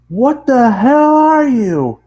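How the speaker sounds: high, loud, slow blurt; blurted shout. Acted. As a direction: demand it, stunned. Narrator blurts question.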